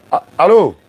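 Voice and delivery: high loud hello into the phone; loud phone call. Real, not acted. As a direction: call out over a bad line. Appel téléphonique. (allô crié au téléphone)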